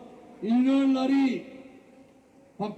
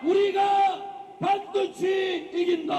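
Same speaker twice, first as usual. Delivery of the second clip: high, slow shouted battle cries; roaring rallying speech; 7 semitones higher than usual. Real, not acted. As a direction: fire up the comrades, no fear. (힘차게 외치는 투쟁 구호)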